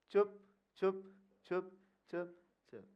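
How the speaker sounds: quick string of curt chups; repeated chup. Retold or imitated. Teacher showing children hushed again and again, rapid chups. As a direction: fire off a rapid row of hushing chups. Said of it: "that final chup".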